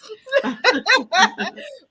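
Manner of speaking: high cackling laughter; high laughing fit. Real, not acted. Host laughing at her own joke, high laughter. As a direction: let the laugh run high.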